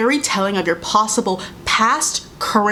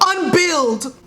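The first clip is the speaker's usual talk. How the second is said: mocking retort; 5 semitones higher, much slower than usual. Real, not acted. Mocking retort to predator men.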